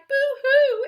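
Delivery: high, loud mock boo-hoo; rat crying. The scared rat cries out, loud and high. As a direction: wail it like a startled rat. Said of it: "who cried".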